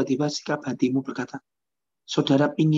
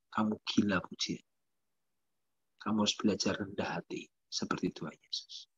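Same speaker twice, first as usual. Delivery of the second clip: much lower and much softer rebuke; God's rebuke; 7 semitones lower, 11 dB quieter than usual. Acted. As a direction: deliver the rebuke low and hushed, like a voice from within.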